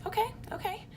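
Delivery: high, breathy-free squeak of awkward okay; stunned okay. Retold or imitated. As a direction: play it like you're caught off guard and nervously agreeing. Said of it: "and we're like".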